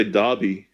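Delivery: Derby with an ah vowel; DAR-bee pronunciation. Shown as a demonstration. Co-host answering the host, Derby said DAR-bee. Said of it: "I say Derby".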